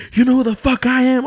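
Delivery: high, loud threat; mock an outraged mother confronting an intruder; angry mother-in-law. Mother-in-law confronts caught boyfriend, high and furious.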